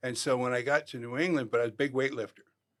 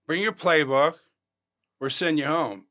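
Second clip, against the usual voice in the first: loud call; 4 semitones higher, 7 dB louder than usual. Retold or imitated. Voice at the door repeats.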